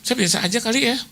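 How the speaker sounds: high, hurried pleading "Bu, Bu, jangan libatkan saya"; mock the woman backing out in a panic; panicky high voice. Imitating the donor refusing on the phone, high and panicky. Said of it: "Ya, jadi Bu yang itu".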